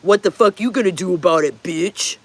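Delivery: lightly voiced aggressive drunk; mock drunk threat to the bridesmaid; drunk guy. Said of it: "some drunk guy got in her face and said".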